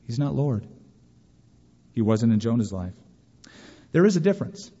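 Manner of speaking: usual speaking voice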